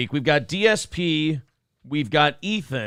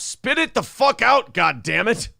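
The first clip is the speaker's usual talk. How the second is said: angry yell; 9 semitones higher, 3 dB louder than usual. Acted. Reading an angry all-caps chat.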